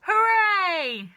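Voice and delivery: very high, loud cheer. Acted. Father cheering that grandma is gone, very high and loud. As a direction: cheer with delight. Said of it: "said Mister Cranky".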